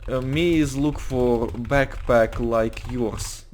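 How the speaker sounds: put-on slow, broken English with a Russian accent; fake Russian accent. Anton asking a stranger about a backpack, fake Russian accent. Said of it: "I'm just trying to fake a Russian accent".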